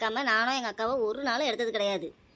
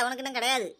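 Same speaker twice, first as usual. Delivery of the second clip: ordinary delivery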